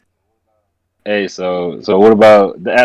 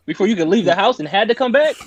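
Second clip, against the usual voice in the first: much higher, disbelieving tease; who talks to grandma like that; incredulous; 8 semitones higher, 3 dB louder than usual. Real, not acted. Incredulous teasing question.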